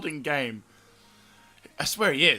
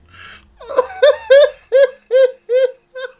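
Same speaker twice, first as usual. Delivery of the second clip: high, loud peals of laughter; uncontrollable giggles; 16 dB louder than usual. Real, not acted. Player overcome by the absurd game, uncontrollable laughter. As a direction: laugh helplessly in repeated gasping bursts. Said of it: "I got the giggles now".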